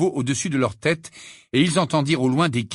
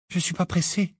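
higher, softish, tired remark; weary; 6 semitones higher, 3 dB quieter than usual. A narrator's reading. Hermione in no hurry to break news, weary. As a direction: say it resignedly, a touch worn out. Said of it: "d'une voix lasse".

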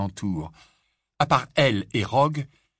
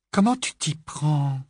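envious; 6 semitones higher, 4 dB louder, slower than usual. Acted. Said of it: "une certaine envie dans sa voix".

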